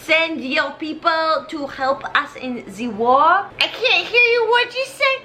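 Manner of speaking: lightly put-on French accent; a high comic Lafayette in French accent. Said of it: "But do it in a French accent".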